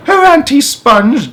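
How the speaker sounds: crying out. Acted. James begging his aunts for a trip, high pleading child. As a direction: cry out as a desperate little boy. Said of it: "James cried out".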